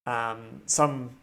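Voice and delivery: dramatic GM narration, setting the scene for the players; game master scene setting. Acted. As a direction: draw the table into the story like a storyteller. GM sets the opening scene.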